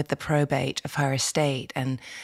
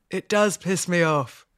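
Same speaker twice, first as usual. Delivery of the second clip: dry dying mother. Retold or imitated. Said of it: "my mother was like".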